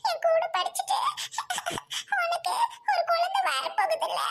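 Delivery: high-pitched sobbing complaint; tearful wailing. Acted. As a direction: wail it out through sobs. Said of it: "ஏன் அழுகுற?".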